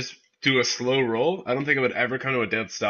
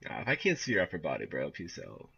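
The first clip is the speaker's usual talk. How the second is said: near-whisper; 11 semitones lower, 11 dB quieter than usual. Real, not acted. Quiet reaction to video.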